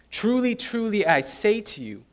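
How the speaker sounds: "Truly, truly" pitched high, then dropping on "I say to you"; solemn stress on "Truly, truly"; emphatic. Shown as a demonstration. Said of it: "it adds emphasis to what comes afterwards".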